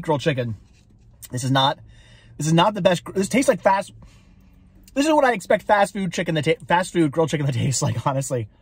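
eating between words; talking while chewing. Real, not acted. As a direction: mouth partly full of food. Seen 0: Holds chicken piece, bites at 1.2, 4.9; lips closed between words.

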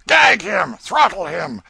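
very high, loud outburst; furious shouted order. Acted. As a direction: roar it at the guards to silence him. Count orders chattering player silenced.

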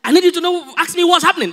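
high, loud whiny plea; pleading woman. Acted. Upset wife begs husband to stay, high whiny plea.

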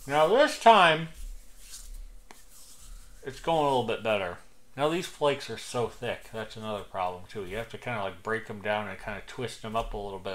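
talking around pipe stem; pipe clenched in mouth. Real, not acted. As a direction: teeth gripping pipe while speaking. Seen 0: Pipe stem held between teeth in every labelled frame.

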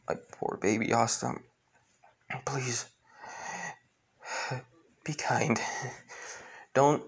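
soft, whispered last words; dying mother whispering to her son; whispered farewell. Acted.

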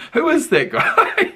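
high, squeaky question through laughter; laughing. Real, not acted. Asks who the stranger is, laughing hard. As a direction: squeeze the question out while laughing.

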